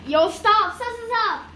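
urgent shouting. Real, not acted. Cheese poured too much.

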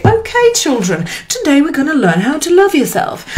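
high, bright-voiced teacher addressing children; mock teacher. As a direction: play a cheerful teacher starting class. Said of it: "a curriculum and say".

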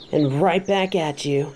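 ordinary talk

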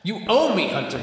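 loud, high accusing cry; high desperate shout. Acted. Caged vampire shouts after the departing hunter, high and desperate. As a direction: hurl it after her.

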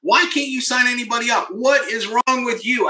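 high-pitched retold exasperation; acted-out frustrated outburst, high; mad and frustrated. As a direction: demand it in mock outrage, pitch way up. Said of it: "I would get so mad and so frustrated".

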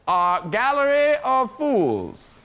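ordinary talk, nothing marked

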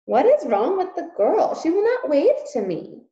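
put-on high, loud young princess calling; little princess. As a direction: call up to papa like a spoiled little princess. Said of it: "called the princess".

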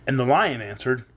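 ordinary delivery

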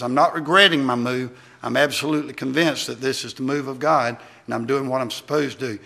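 normal talking voice

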